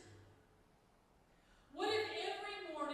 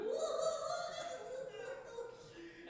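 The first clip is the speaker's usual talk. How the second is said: a high laugh half like a sob; high tearful laugh; 13 semitones higher, 4 dB louder than usual. Real, not acted. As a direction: laugh at the memory of crying. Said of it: "I'm weeping".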